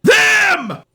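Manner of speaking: high, loud, drawn-out cry of THEM; exclamation-point shout. Pretend. Host announces a monster classic, shouting its title. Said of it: "You have to say it that way".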